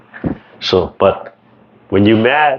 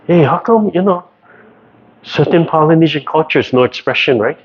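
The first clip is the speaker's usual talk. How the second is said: naive wondering; 7 semitones higher than usual. Retold or imitated. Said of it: "We think like".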